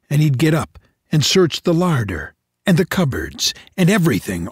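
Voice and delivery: usual speaking voice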